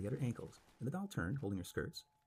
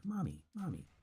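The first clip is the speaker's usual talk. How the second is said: higher, terrified calling for mother; frightened scream; 9 semitones higher than usual. A narrator's reading. Miss Harper flees the toys, mild frightened scream. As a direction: cry out for mommy in panic. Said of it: "she screamed".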